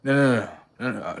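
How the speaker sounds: ordinary talk, nothing marked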